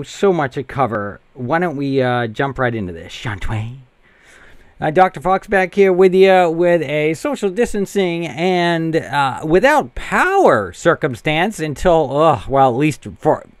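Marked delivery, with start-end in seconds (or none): 4.8-13.5 giddy. Real, not acted. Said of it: "if I sound a little giddy and excited".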